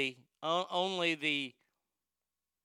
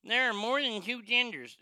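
higher, louder mocking whine; mocking whiny voice; 7 semitones higher, 6 dB louder than usual. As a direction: mimic them with a sneer. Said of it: "these fuckers that say".

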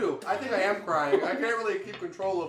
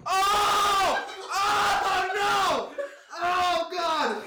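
high pained yells, eye stinging; pained yelling; 12 semitones higher, much slower than usual. Real, not acted. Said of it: "I touched my eye".

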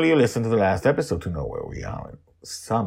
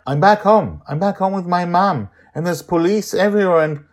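put-on higher, louder boy's voice, tumbling out; boy Charlie; 10 semitones higher, 10 dB louder than usual. Charlie telling Joy he got home, excited. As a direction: blurt it in a rush. Charlie explains his return.

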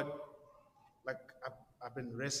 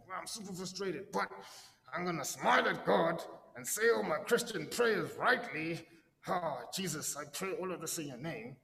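hammed-up cheerful prayer in a higher pitch; falsely pious; 5 semitones higher, 6 dB louder than usual. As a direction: put a mock-devout gloss over frustration. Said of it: "trying to Christianize it and be like".

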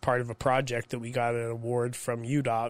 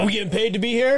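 higher, louder, fast indignant whine; mock worker complaint; 8 semitones higher, 7 dB louder, faster than usual. Mocking the workers who skip parties, fast indignant gripe. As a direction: fire it off like a grumbling worker.

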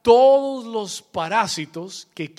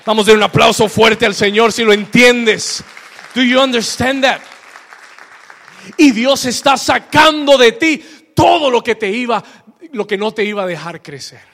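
full-force preaching; 3 semitones higher, 8 dB louder than usual. Real, not acted. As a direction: proclaim God's promise at full volume. (predicación a toda voz)

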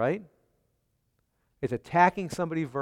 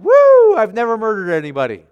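a sudden high, loud "Woo", then fast and pleased with yourself; the hearer patting himself on the back, rushing the words out; smug relief; 6 semitones higher, 10 dB louder than usual. Retold or imitated. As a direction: congregation member sure he's off the hook, mock smug. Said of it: "everyone who heard verse twenty-one and said".

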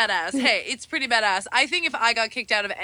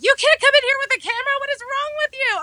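high, fast scolding yell; screaming nun; faster than usual. Retold or imitated. Buddhist nun scolding camera-carrying visitor, high fast yell. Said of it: "the Buddhist nun was screaming".

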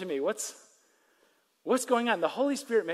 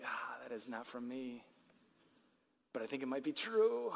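much softer, lower inner voice; hushed realization; 7 semitones lower, 11 dB quieter than usual. Retold or imitated. A believer startled by a Spirit prompting, hushed. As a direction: murmur it to yourself, unsettled. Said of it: "and you're like".

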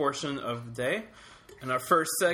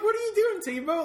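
high-pitched incredulous quote; mock disbelief. Host mocking Tebow's preacher outfit, high incredulous voice. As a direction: shoot the voice way up, mock-baffled.

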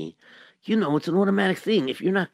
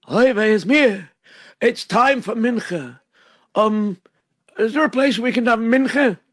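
loud rebbe voice; 4 semitones higher, 6 dB louder than usual. Acted. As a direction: call out like a rebbe. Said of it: "he said".

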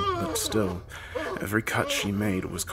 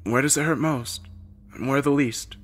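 higher-pitched nurse's voice; lightly voiced woman examining a patient; questioning; 6 semitones higher than usual. A narrator's reading. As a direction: ask steadily, one question then the next. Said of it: "asked a few simple questions".

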